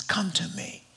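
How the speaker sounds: soft breathy whisper of come to me; whispered invitation. Acted. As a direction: whisper it like a gentle call. Jesus' invitation quoted tenderly.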